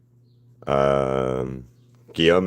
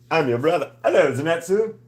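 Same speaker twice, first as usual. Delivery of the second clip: high, playful put-on voice saying hello; high mock greeting; 9 semitones higher than usual. Streamer greets her drawing in a high silly voice. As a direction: pitch it way up like a silly brother.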